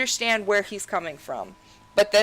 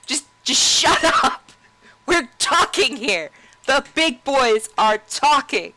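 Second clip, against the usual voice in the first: high, loud mock yell; hammed-up angry shouting; Anduin yelling; 6 semitones higher, 7 dB louder than usual. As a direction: shout him down like a bratty young king. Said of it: "And Anduin's like".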